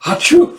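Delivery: high, loud spoken sneeze; mock sneeze. Audience member interrupting the performance, high mock sneeze. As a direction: fake a sudden sneeze. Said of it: "you see a little".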